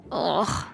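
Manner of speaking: low, softish "ugh"; revolted low grunt; disgusted groan. Acted. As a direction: groan at a sickening memory. Recalls mother's mashed carrots.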